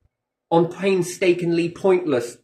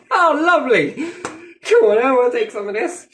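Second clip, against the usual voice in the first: high, loud, laughing delight; 8 semitones higher, 6 dB louder than usual. Acted. Buyer thrilled with the cheap energy deal, high laughing cry. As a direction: squeal it out like you've just got a bargain. Acting an eager buyer, role-play.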